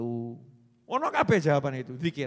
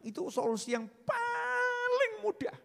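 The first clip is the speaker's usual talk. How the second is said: mock crying; 3 dB quieter than usual. Mock-tearful punchline in sermon.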